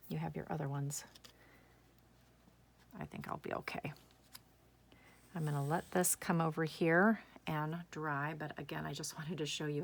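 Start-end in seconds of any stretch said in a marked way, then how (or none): 3.0-3.9 under-breath reassurance; whispered to herself. Real, not acted. Crafter reassuring herself after the glitter spill, whispered. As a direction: whisper it as a private aside.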